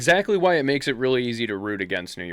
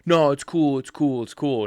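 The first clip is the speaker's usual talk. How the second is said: loud, higher-pitched imitation of a placating bystander; mock placating; 6 semitones higher, 6 dB louder than usual. Bystander to the upset bus driver, mock placating. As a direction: brush it off loudly, imitating some dude. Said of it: "one dude's trying to be like".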